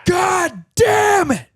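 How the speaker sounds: high, drawn-out mock scream; very loud scream. Imitating the old man yelling at his dog, very loud scream. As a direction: bellow it high, stretching both words. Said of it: "very loud".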